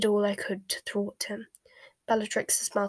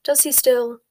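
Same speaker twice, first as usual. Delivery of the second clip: higher, louder sceptical question; delicate disbelief; 4 semitones higher, 7 dB louder than usual. A narrator's reading. Snape needles Bellatrix after her boast, disbelieving. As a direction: lift the question in pointed doubt. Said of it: "delicately inflected to suggest his disbelief".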